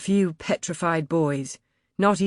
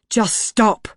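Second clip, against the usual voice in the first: loud, high, commanding stop; firm shout; 7 semitones higher, 5 dB louder than usual. A narrator's reading. Narrator holding Margaret's arms, loud commanding shout. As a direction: grip her arms and order her to stop.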